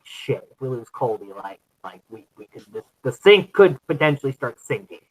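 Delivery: ordinary talk, nothing marked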